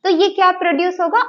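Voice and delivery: ordinary talk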